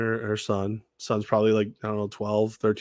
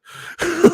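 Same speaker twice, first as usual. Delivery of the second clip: high loud burst of laughter; 8 dB louder than usual. Real, not acted. Host laughs out loud retelling the villain name mixup. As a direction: crack up at the memory.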